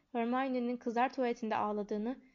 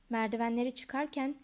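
ordinary delivery

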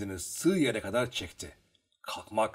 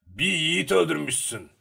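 put-on reproachful old man; 5 semitones higher, 5 dB louder than usual. A narrator's reading. Old man's reproach in narrated dialogue.

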